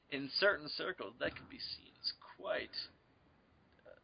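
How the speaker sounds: very soft, halting murmur; hushed, hesitant innuendo; mock hushed aside. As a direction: trail along softly in mock discretion. Hushed echo of partner's innuendo.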